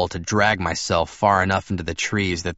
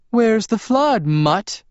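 ordinary delivery, nothing marked

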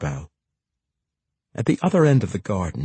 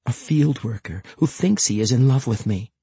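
ordinary delivery

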